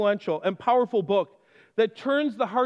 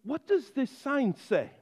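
polite officer; 3 semitones higher, 5 dB quieter than usual. Retold or imitated. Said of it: "police officer very politely said".

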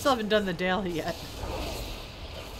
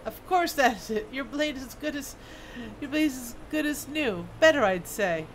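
proud dwarf smith; 3 semitones higher than usual. Acted. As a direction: play a smith defending his handiwork. Voices smith in game.

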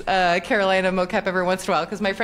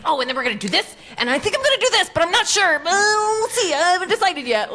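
gleeful writer; 9 semitones higher, faster than usual. Retold or imitated. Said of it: "he was just so gleeful".

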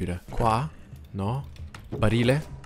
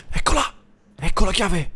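high, loud triumphant cry; excited; 11 semitones higher, 9 dB louder than usual. Real, not acted. Gamer finding the lost key, high excited cry. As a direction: burst out with joy at the find.

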